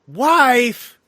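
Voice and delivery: high, loud one-word outburst; mock exclamation. Host acts out a boss's slip about a wife, loud mock exclamation. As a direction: blurt it out, imitating a movie character. Acts out movie character's blurted line.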